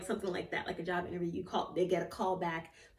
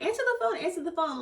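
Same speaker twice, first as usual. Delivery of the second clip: urging friend; 9 semitones higher, faster than usual. Retold or imitated. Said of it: "my friend's just like".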